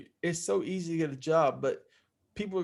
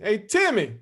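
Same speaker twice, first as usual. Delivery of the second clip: dad's phone call; 5 semitones higher, 3 dB louder than usual. Retold or imitated. Dad calls on phone.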